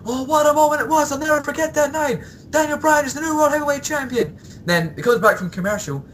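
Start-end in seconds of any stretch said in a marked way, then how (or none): 0.0-4.4 mock gushing commentator. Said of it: "Jerry Lawler is like".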